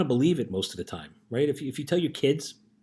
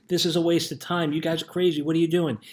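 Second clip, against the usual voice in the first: higher-pitched retold heckling; mock yelling; 6 semitones higher, 4 dB louder than usual. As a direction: call out like a crowd discouraging someone. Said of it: "are yelling and screaming".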